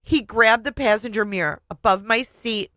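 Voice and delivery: ordinary delivery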